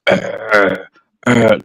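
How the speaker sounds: low mock dog growl; imitating a dog growling at strangers. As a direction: growl like an angry pit bull. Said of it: "growling like".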